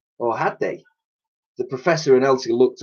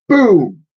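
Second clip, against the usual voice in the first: loud booming thunder cry; 9 semitones higher, 8 dB louder than usual. A narrator's reading. Narrator booms the thunder loud. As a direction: boom it out like thunder.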